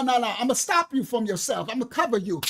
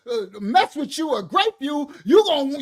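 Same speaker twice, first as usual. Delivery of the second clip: ordinary talk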